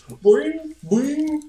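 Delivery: high, loud cartoon "boing"; spring upward on each boing; imitated jump sound. Host mimicking the commercial guy's jump, high springy noise. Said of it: "he jumps and he goes".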